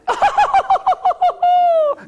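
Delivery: very high ringing laugh; princess laugh. Acted. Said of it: "and the princess went".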